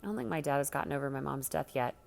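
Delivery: ordinary delivery, nothing marked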